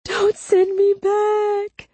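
very high, drawn-out wail; desperate begging. A narrator's reading. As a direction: wail the plea slowly in terror. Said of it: "Wright begged".

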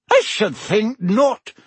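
high, slow, indignant retort; sharp. A narrator's reading. Professor objects to Dementors in the castle, sharp. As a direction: refuse it sharply, weighing each word. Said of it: "said Professor McGonagall sharply".

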